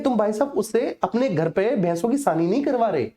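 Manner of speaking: ordinary talk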